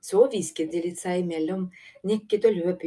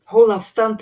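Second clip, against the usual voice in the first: loud, urgent warning shout; 3 semitones higher, 11 dB louder than usual. A narrator's reading. Håp warns everyone as the cave shakes, shouting. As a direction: shout to make them keep clear. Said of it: "ropte Hope".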